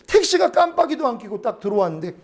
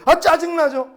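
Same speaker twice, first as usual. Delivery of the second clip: higher-pitched, acted-out irritation; annoyed retort; 6 semitones higher than usual. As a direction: snap back at God in mock annoyance. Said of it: "나 지금 짜증나가지고".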